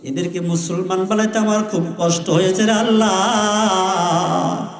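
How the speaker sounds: chanted lament with long-drawn calls on Allah; imitated tearful plea. A narrator's reading. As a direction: plead to God in a melodic preacher's wail. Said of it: "আমার নবী কেঁদে কেঁদে বলে".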